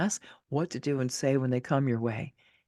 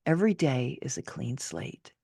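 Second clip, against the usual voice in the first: ordinary delivery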